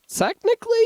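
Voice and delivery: technically swooped up high with a rising tune; a cheeky, pointed correction; teasing know-it-all aside; sassy technically. Real, not acted. Sassy aside on car loans.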